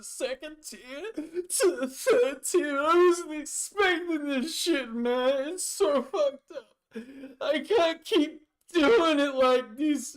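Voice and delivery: high, loud, sobbing wail of despair; crying rant. Acted. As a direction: wail through tears about having nothing. NPC breaks down, dry heaving.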